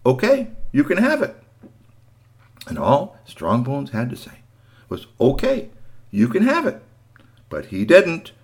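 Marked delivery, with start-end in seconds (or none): 0.0-1.4 put-on boy's voice. Boy's line in a bedtime story. 5.2-6.8 a storyteller voicing the boy's meek giving in; high boyish voice. The expected line the boy never said.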